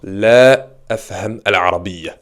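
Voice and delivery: slow La afham al-arabiyya, La stressed; teacher sets the negation out slowly; slow model phrase. Shown as a demonstration. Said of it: "On va utiliser la". (lentement, en appuyant sur La)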